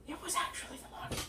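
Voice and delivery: quick, hushed whisper; whispered aside. Pretend. Reviewer confiding to viewers about the toy, quick whisper. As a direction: whisper it fast like a secret.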